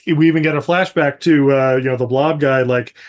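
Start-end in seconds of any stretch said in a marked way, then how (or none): none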